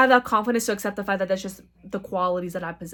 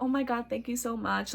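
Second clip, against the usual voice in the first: sweet gratitude; 8 dB quieter than usual. Retold or imitated. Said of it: "express your gratitude".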